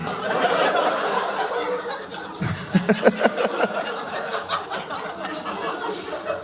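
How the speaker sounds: long breathless high giggle; high airy laugh. Real, not acted. Comic cracking up at his own John Cena joke, airy laugh. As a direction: wheeze with laughter.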